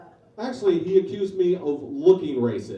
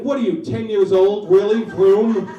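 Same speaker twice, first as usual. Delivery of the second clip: incredulous retort; 4 semitones higher, 9 dB louder than usual. Retold or imitated. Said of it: "I'm like".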